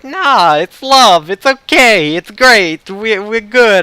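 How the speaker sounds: high, loud, bad Brooklyn accent; hammed-up Brooklyn brush-off; terrible Brooklyn accent. Game character waving off the objections, terrible Brooklyn accent. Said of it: "a terrible, uh, attempt at a Brooklyn accent".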